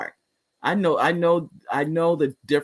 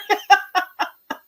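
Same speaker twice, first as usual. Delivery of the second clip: high laugh. Real, not acted. Host laughs at breaking her resolutions, high laugh.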